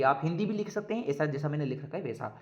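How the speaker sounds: ordinary talk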